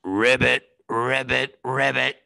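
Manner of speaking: low, lightly voiced ribbits; frog in belly. Teller voicing a frog in the stomach, low croak. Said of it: "Your own stomach is going".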